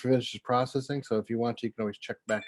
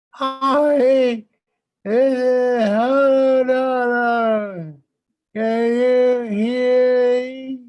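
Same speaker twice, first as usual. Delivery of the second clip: much higher, slow and loud greeting; 13 semitones higher, 9 dB louder, much slower than usual. Pretend. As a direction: stretch each word way up high. Greeting viewers in put-on voice.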